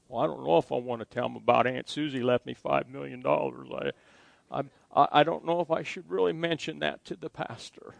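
a hint of a hesitant congregant, dropped low and soft; voicing a congregant. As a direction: mumble it like a churchgoer talking himself out of telling the pastor. Congregant's hesitant inner thoughts voiced.

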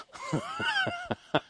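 delighted squealing laugh at the memory; high squealing laugh. Real, not acted.

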